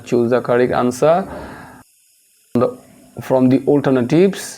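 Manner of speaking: very slow, word-by-word exam instructions; teacher reading instructions to class; slow dictation reading. Acted. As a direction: read the instruction out slowly.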